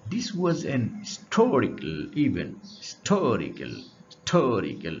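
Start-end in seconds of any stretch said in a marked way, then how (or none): 1.0-2.0 historical with a faint first syllable and a big STOR; second-syllable stress. Shown as a demonstration. Teacher reading why an is used, stress on hiSTORical. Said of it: "শেষের সিলেবলে জোর দেয়া". 2.8-5.0 historical almost losing its first syllable, STOR hit and falling; second-syllable stress. Shown as a demonstration. Teacher repeating the example for students, stress on hiSTORical. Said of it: "এই জন্য".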